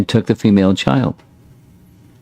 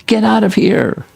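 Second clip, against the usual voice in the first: frantic yell; 13 semitones higher than usual. Acted. Yashoda yelling at the supposed witch, high frantic shout. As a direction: cry out to drive the witch away. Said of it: "Yashoda became bewildered and yelled".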